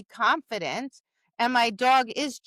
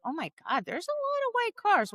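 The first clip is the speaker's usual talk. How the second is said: mock surprise; 10 semitones higher than usual. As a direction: gasp it out like a sudden discovery.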